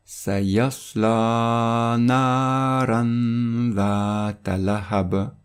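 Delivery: slow recitation, the final n of "naran" held through the nose before "dhata"; "Sayasla naran dhata lahab" chanted slowly with long vowels and a veiled n; prolonged nasal n. Shown as a demonstration. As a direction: hum the n of "naran" for two beats. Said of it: "on va prolonger le noun du tanwin".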